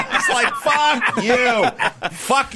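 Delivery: laughing mock outrage. Real, not acted. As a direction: laugh through the insult. Laughing at the doctor's reply.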